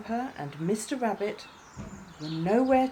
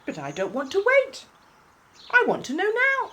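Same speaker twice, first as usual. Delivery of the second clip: high, insistent whine of a little bunny; impatient whine; 13 semitones higher, 6 dB louder than usual. Acted. Wobble whines to Jumper, wanting to know now. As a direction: demand it right now, pitch climbing.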